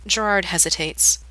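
usual speaking voice

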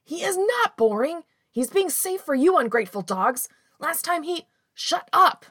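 high, shouting from another room; muffled shouting. Acted. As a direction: yell it through a closed door. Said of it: "Jinling's muffled voice rises".

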